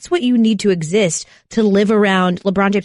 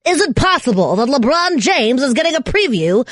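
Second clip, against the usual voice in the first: announcer voice; 6 semitones higher than usual. Acted. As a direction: hype it like a dramatic promo announcer. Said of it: "announcer voice".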